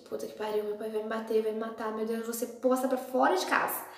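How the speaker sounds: soft, dropped-voice panic; fearful inner panic. Retold or imitated. Daughter alone, fearing her father, soft panicked mutter. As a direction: whisper your fear to yourself.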